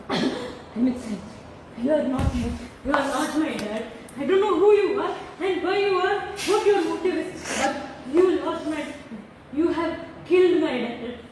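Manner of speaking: soft, low, slow heartbroken voice; quiet grief. Real, not acted. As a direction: let it sink as if mourning him. Daughter grieving the father she lost.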